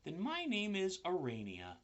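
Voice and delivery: high small voice; little spider voice. A narrator's reading. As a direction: spider choosing its name. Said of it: "said the spider".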